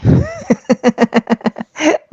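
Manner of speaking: a short, high scornful laugh; mocking laugh. Real, not acted. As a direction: chuckle at the ridiculous story. Preacher mocks the convert's claim.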